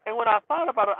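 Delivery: ordinary delivery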